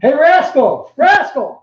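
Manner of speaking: loud, high call to a pet; calling the dog. Real, not acted. Host calling out to the dog Rascal across the room, loud and high. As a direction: sing out his name to get him to come.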